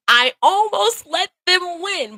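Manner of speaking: slow forceful confession; emphatic realization. Real, not acted. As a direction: lean hard into the admission. Emotional peak.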